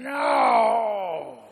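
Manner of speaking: a high, loud "No!"; retold cry. Waking up in the dark and hearing Charlie, loud panicked cry. Crying out when he hears the voice.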